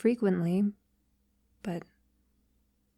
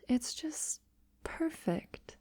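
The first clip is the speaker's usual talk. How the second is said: whispered, rising higher on perfect; delighted whisper; 7 semitones higher, 9 dB quieter, slower than usual. Acted. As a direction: breathe it out, thrilled. Delighted hushed aside.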